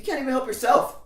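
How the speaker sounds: high, loud sarcastic jab; snippy retort. Retold or imitated. Donna mocking her girlfriend's plan. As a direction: snap back with scorn. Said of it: "And Donna's like".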